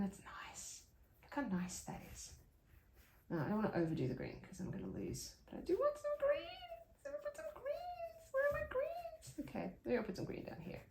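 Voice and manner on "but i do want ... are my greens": high, whiny cat-like voice; whining cat. Real, not acted. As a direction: whine it like a cat. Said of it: "I sounded like a whining cat".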